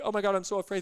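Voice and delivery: high, loud, hammed-up panic; mock fear. Telling a normal person, in hammed-up high panic. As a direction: overplay being scared. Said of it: "if you go to any normal person".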